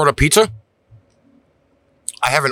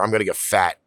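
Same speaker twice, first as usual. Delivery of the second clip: normal talking voice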